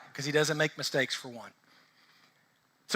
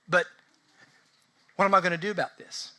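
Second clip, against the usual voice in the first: ordinary talk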